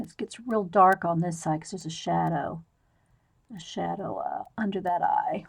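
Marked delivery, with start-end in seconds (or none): none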